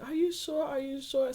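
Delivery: high-pitched mimicked doubt; doubtful questioning. Husband asking over and over, doubtful. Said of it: "he keeps telling me".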